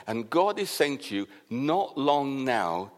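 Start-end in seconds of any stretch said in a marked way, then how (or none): 1.5-2.9 slow solemn answer. Retold or imitated. God's answer quoted slowly.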